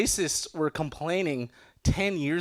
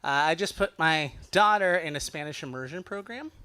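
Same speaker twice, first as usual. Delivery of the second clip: ordinary delivery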